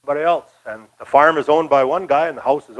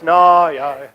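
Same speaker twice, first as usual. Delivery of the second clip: usual speaking voice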